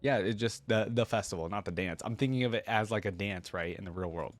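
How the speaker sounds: normal talking voice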